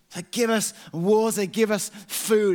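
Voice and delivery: high, loud whiny demands; imitating grumbling Israelites; mock moaning. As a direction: whine for water and food. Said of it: "and just moan and complain".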